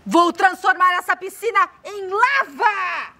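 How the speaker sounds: low wicked witch voice; gleeful evil witch plotting; voz de bruxa. Acted. Criança faz a voz da bruxa. (bruxa malvada, grave e divertida)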